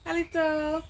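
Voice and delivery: sing-song high greeting called through the house; calling out a greeting. Retold or imitated. Coming home, calling a greeting to the family, high and loud.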